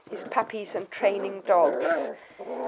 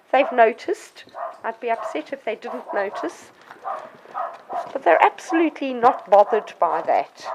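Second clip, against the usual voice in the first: normal talking voice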